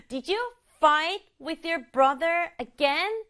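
slow question with "fight", "brother" and "again" stressed; stress on key words. Shown as a demonstration. Teacher models the question rhythmically, stressing fight, brother, again. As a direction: punch "fight", "brother" and "again", stretching the line out. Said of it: "어디 강조하면 좋을 것 같아요".

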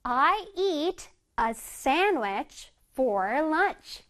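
very slow, words drawn out; slow model reading. Shown as a demonstration. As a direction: read slowly for the class to copy. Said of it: "예문에서 보자".

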